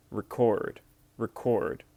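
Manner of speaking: "record" with the weight on CORD, /rɪˈkɔrd/; stress on second syllable. Shown as a demonstration. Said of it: "the stress is on the second syllable".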